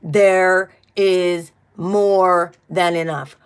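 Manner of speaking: very slow, word-by-word affirmation; slow, deliberate declaration for others to join; say-along affirmation. Shown as a demonstration. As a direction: lead a group repeat, drawing out each word. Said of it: "Say it with me".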